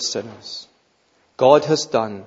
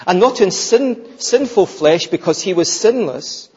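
loud, raised, contrastive stresses; emphatic preaching; 6 semitones higher, 6 dB louder than usual. Acted. Preacher explaining Christ's likeness to sinful flesh, forceful and emphatic. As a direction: hammer out each distinction.

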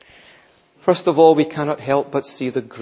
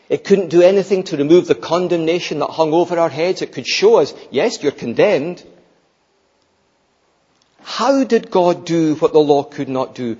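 louder, insistent pulpit voice; forceful preaching; 4 semitones higher, 6 dB louder than usual. Acted. Preacher pressing the congregation on what the law could not do, forceful.

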